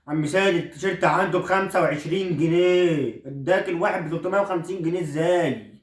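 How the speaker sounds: a son protesting he will never wear them; indignant son. Acted. Son mocks uncle Sayed deal.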